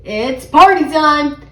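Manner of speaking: loud, drawn-out cheer; gleeful party call; grinning. A narrator's reading. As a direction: stretch it out with a grin. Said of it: "Peter said, grinning".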